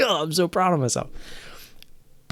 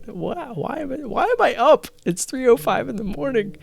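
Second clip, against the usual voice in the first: much higher, whining at himself; 11 semitones higher than usual. Real, not acted. Drunken self-pity whine.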